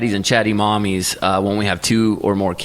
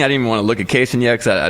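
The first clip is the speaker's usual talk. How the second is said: ordinary delivery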